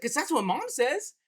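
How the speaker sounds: put-on a child stating the obvious; mock little girl, plain as day; imitating his daughter. Daughter quoted in a story.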